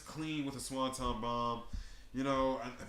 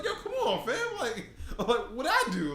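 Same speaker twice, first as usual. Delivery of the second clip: very high, laughing imitation of a plea; hammed-up squeaky protest; mock pleading; 10 semitones higher, 4 dB louder, slower than usual. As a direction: plead innocence in a comic high voice. Acting out Miz's pleading, laughing.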